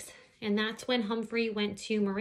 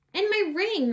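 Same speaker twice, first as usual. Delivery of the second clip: high, loud outburst over my; indignant; 11 semitones higher, 6 dB louder than usual. Acted. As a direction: cry out at the stolen ring. Miranda finds her ring.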